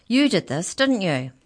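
loud, high accusation; accusing snap. A narrator's reading. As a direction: round on the culprit angrily. Mallory rounds on mouse, accusing it.

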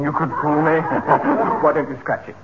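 normal talking voice

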